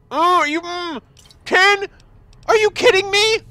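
very high, loud squeal of disbelief; squealing delight. Real, not acted. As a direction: shriek like you won the lottery. Ten items found.

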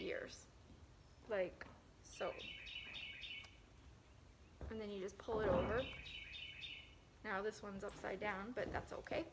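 fabric-muffled speech; through a cloth mask. Real, not acted. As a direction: mouth covered by mask. Seen 1: Cloth mask covers her mouth and nose while she speaks throughout.

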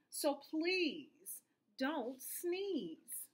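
drawn-out, very slow plea; slow pleading. Acted. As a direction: stretch the plea out slowly. Hippo pleads, stretched out.

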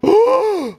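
high, loud gasp; shocked gasp. Real, not acted. Gamer seeing the worst spawn ever, shocked gasp.

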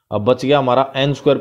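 ordinary talk

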